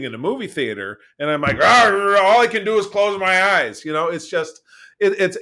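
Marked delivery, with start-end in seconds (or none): 1.6-3.8 loud, higher hammed-up groan; mock agony. Host pretending he's trapped in a cinema, loud mock anguish. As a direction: play the helpless captive viewer, loudly.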